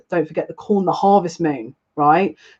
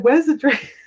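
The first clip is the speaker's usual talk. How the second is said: disbelief; 8 semitones higher than usual. Retold or imitated. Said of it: "I said".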